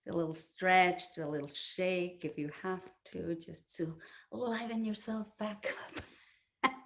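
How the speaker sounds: soft guided-meditation close. Acted.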